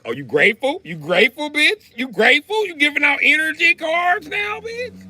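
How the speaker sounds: escalating indignant questions, climbing to a squeal; mock outrage. Man confronting his woman over energy cards in a skit, mock outrage. Acting out a mocking row with a woman.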